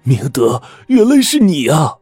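overjoyed recognition, voice jumping up; joyful surprise. Acted. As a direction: cry out as if an old friend appeared. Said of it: "车宝瑞一脸的惊喜啊".

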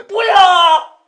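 high, loud desperate shriek; hysterical scream. Acted. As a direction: scream it out in panic. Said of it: "我歇斯底里的大叫".

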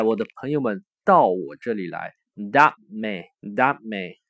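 ordinary talk

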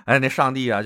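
ordinary talk

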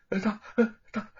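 stammering, terrified, breaking off; trembling voice. A narrator's reading. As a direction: stutter in fear, shaking. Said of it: "颤抖着声音说".

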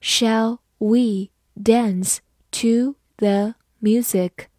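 slow, one word at a time; teacher's word-by-word read-along. Shown as a demonstration. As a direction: read it slowly with clear gaps between words. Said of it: "慢慢来读".

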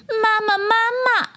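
put-on very high childlike calling; tadpole calling out. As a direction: call for mama as a tiny tadpole. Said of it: "追上去喊". (小蝌蚪尖声喊妈妈)

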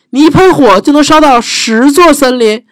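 high, eager wide-eyed question; butter up the dragon; flattering princess. Acted.

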